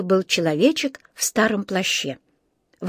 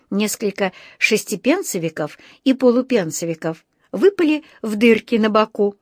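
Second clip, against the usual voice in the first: usual speaking voice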